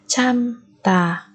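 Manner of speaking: slow 참다 with a gap; syllable by syllable. Shown as a demonstration. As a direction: split 참다 into two beats. Teacher splits word by syllable. (đọc chậm 참다 từng âm tiết)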